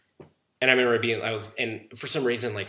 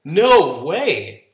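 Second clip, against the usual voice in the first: loud, higher exclamation; retold amazed disbelief; 5 semitones higher, 9 dB louder than usual. Retelling receiving a dream Porsche, amazed disbelief. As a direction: blurt it out in happy astonishment.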